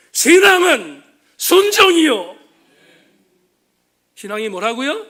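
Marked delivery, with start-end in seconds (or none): 0.1-2.4 "신앙은 순종이요" cried out much louder and higher, slow, dialect ending 이요; Pastor Ok's Gyeongsang shout. Retold or imitated. Preacher echoing a dying pastor's cry to his church, loud slow shout. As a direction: hammer home "신앙은 순종이요" as an impassioned Gyeongsang plea. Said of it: "경상도 사투리로 이렇게 외쳤던".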